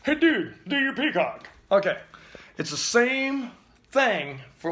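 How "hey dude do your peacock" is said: friend asking. Retold or imitated. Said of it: "Everybody goes".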